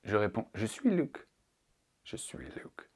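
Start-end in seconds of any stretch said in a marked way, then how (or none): 2.0-2.9 very soft, voiceless whisper. Acted. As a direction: whisper it as a hushed aside. Repeats joke answer under breath. (chuchoté, à peine audible)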